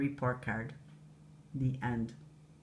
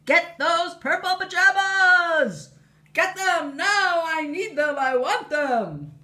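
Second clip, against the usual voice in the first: much higher, louder, slow demanding yell; screaming queen; 12 semitones higher, 7 dB louder, slower than usual. Acted. Queen yelling at soldiers in the distance, hammed-up scream. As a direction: scream after the fleeing boy. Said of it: "Queen Dentina screaming".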